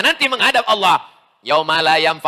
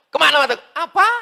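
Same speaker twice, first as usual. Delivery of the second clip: higher, mock gruff challenge; blunt Sumatran retort; 10 semitones higher, faster than usual. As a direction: toss it out like a brash Sumatran. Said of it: "kalau ngomongnya nggak keras, nggak kedengaran".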